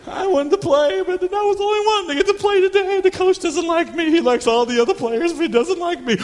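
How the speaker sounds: mock child whine; whiny child. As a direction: pout like a left-out kid.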